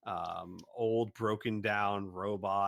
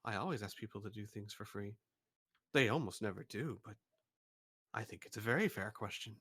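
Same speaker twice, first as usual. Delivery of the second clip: fast, softer deadpan; mock serious; 3 semitones lower, 5 dB quieter, much faster than usual. Shopkeeper answering the joke quickly in mock seriousness. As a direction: rattle it off with a straight face. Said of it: "looks at you very seriously and says".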